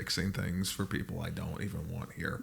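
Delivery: quiet, low-key grumble; grumbling dad. Acted. Dad fixing things for unwanted guests, soft grumbling. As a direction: complain softly to himself as a put-upon dad. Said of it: "your dad would be like".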